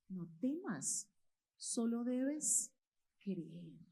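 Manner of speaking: slow, softened words of comfort; slow reassurance. Acted. Jesus telling the synagogue leader not to fear, slow and reassuring. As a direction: reassure gently, drawing out each phrase.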